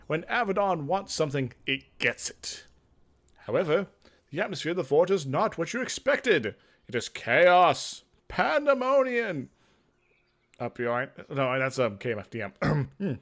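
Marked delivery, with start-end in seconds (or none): 3.5-9.5 dramatic build. A narrator's reading. Dramatic narration of chaos.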